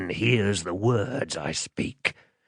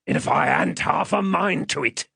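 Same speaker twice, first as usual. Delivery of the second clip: higher, menacing rough-man voice; threatening; 8 semitones higher than usual. Acted. Man with a threatening shake of his head, menacing. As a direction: make it a looming threat. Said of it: "with a threatening shake of his head".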